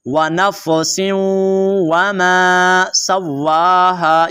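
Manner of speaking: नफ़सिन वमा joined by a held nasal hum; slow recitation, नफ़सिन ending in ghunna. Shown as a demonstration. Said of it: "यहाँ पे गुन्ना होगा".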